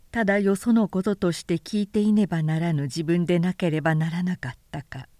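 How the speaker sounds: usual speaking voice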